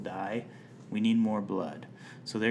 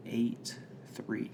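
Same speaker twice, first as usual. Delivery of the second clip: whisper; 10 dB quieter, much slower than usual. Pretend. Reveals cryptic rating.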